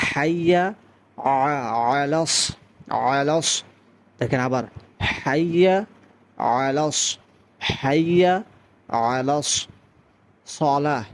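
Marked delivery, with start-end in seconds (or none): 0.1-3.5 হাইয়া with strong h, then a deliberate আলাস; careful teaching demo of হাইয়া আলাস; correct articulation. Shown as a demonstration. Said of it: "বলতে হবে". 5.2-11.0 হাইয়া and আলাস word by word, throat sounds clear; deliberate model of হাইয়া আলাস সলাহ; careful articulation. Shown as a demonstration. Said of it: "এই জিনিসটা লক্ষ্য রাখতে হবে".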